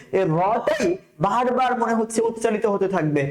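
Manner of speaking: ordinary delivery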